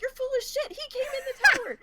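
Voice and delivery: high, loud mock yell of disbelief; retold exasperated yell. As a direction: throw it back at your teammate in panic. Retold panicked outburst at teammate.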